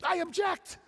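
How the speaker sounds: high, loud objection; protesting crowd. Acted. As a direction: shout out your objection. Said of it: "the crowd says".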